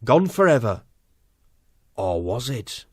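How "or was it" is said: much softer, lower, ominous teaser; dramatic hush. Acted. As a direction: drop it low like a trailer cliffhanger. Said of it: "dramatic narrator question".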